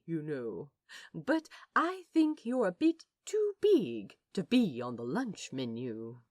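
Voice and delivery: usual speaking voice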